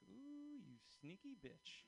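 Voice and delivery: high, slow, drawn-out ooh; mock sly awe. To the saleswoman, a slow knowing tease. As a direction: savor catching her out. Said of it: "And I was like".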